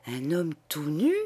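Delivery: «Un homme tout nu?» as a stunned near-whisper of disbelief; stunned stammer. Acted. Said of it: "il bégaya".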